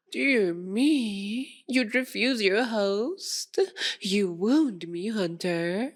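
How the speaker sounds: theatrical gasp, then lilting wounded pride; mock offence. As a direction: play the affronted host, hammed-up. Host feigns hurt at refusal.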